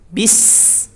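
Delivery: Bis with air pushed out on s; breathy s. Shown as a demonstration. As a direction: exhale through the s of Bis. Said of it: "hembuskan dulu ya".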